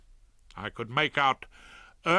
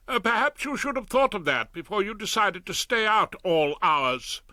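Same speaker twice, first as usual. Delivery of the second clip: a lightly voiced stern wife, a bit higher; Hilda scolding; 8 semitones higher, 4 dB louder than usual. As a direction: tick him off for staying out late.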